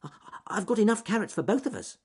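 high, quick little rabbit voice; Ernest Fluffy Rabbit. Acted. Rabbit offering the bear carrots, high and hurried. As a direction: stammer slightly, then rush it out high.

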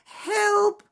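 very high, loud squeaky cries for help; shrieking little squirrel stuck in mud; squirrel in distress. Acted.

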